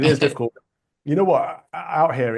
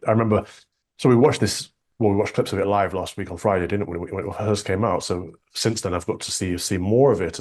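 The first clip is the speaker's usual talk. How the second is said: usual speaking voice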